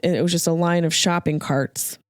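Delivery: ordinary talk, nothing marked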